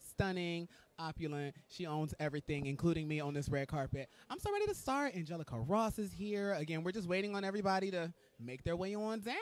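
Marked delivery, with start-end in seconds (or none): 4.3-5.1 jumps high with eagerness; excited squeal. Real, not acted. Host on red carpet, eager to start, squealing. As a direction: squeal it out, bursting to begin.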